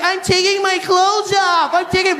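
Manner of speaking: high, slow, innocent put-on voice; clueless woman. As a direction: answer sweetly as if it's normal. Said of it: "She's like".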